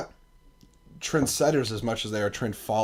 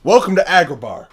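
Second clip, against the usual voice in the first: loud, hyped welcome; announcer; 4 semitones higher, 10 dB louder than usual. Pretend. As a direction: boom it like a showman. Announcing like Will Smith genie.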